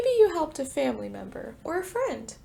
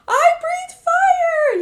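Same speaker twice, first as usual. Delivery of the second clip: a much higher, much louder, slow triumphant cry; joyful yell; 14 dB louder, much slower than usual. Acted. Littlest dragon discovering his fire, joyful yell. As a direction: shout it like you finally did it. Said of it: "yelled the littlest dragon".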